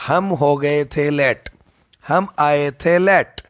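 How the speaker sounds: each word spaced out slowly, landing on late; slow mnemonic drill. Shown as a demonstration. Teacher drilling a mnemonic for students, slow and deliberate. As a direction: spell out the memory trick slowly, with a falling late. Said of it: "तो याद कैसे रखोगे?".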